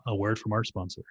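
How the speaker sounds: a hint of a low announcer, softly delivered. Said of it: "I get my announcer voice on".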